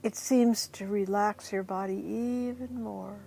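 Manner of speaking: softly guiding breath by breath toward sleepiness; guided hypnosis voice. Acted.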